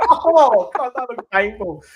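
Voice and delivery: high, loud put-on pained voice; mock wince. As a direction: cringe out loud for the hit player. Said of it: "I was like".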